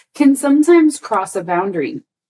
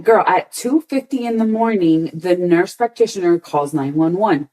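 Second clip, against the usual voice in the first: ordinary talk, nothing marked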